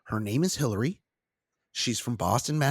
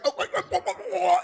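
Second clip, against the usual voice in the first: high, hammed-up climax moaning; rapid run of exaggerated high groans; mock climax moans; much faster than usual. Imitating the distracted husband, in mock ecstatic moans. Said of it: "then he'd be coming again".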